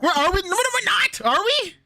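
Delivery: high, disbelieving squeak; surprised. Real, not acted. Surprised a friend claims they're the same age. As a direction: shoot up in pitch, unsure. Surprised about their ages.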